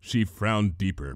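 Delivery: ordinary delivery, nothing marked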